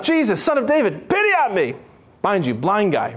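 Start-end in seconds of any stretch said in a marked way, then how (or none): 0.0-1.7 high pleading call, pitched up. Retold or imitated. Blind beggar calling out to Jesus, pleading call pitched up. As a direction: beg to be heard over the crowd. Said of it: "He keeps calling out".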